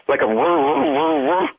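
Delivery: vocal imitation of a whirring engine; imitated car noise. Boyfriend guessing the car's noise, imitated whirring. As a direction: make a whirring motor sound with your voice. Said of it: "what is it, like a".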